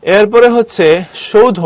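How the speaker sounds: usual speaking voice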